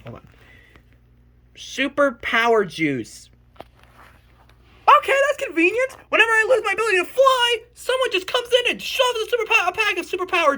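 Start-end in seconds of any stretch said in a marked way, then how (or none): 3.6-10.5 sarcastic rant. Real, not acted. Sarcastic rant about the plot.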